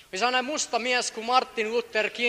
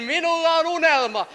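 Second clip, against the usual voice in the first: proclaimed quote; 9 semitones higher, 4 dB louder than usual. Retold or imitated. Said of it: "Martti Lutherkin".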